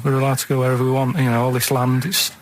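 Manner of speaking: usual speaking voice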